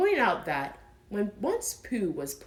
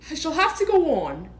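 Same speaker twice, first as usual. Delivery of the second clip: high, louder exasperated sigh of a word; bothered Pooh; 9 semitones higher, 8 dB louder, faster than usual. Acted. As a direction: grumble it like a put-upon bear. Pooh stuck.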